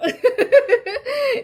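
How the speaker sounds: loud, high burst of laughter. Real, not acted. Proud wife laughing at her husband's honor, loud high laugh.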